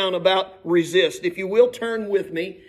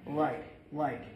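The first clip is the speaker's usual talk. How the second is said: mocking filler like; 6 semitones lower, 9 dB quieter, slower than usual. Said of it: "every other word was like".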